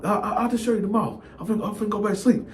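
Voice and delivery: soft, fast mumble; sleepy brush-off. Retold or imitated. Cellmate brushing off the narrator, soft and fast. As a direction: hurry the words out and roll over.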